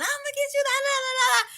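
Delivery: high-pitched mock scolding, running into nonsense syllables; mock cussing. Woman imitates the man cussing at pomegranate thieves, high mock rant. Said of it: "he used to cuss".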